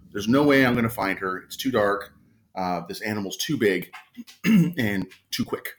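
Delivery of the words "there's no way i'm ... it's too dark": ordinary talk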